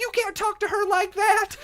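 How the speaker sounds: high, slow, laughing scold; mock outraged voice. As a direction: play the offended regulars through a laugh. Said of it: "They popped up".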